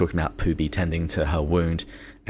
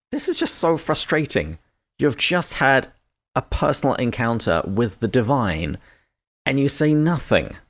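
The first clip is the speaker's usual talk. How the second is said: British-sounding exasperation; 6 semitones higher than usual. Real, not acted. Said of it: "you sounded just like my old British roommate".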